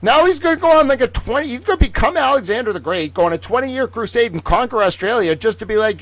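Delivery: high, loud, worked-up rant; escalating rant. Real, not acted. Streamer ranting to chat about Koala, high and loud.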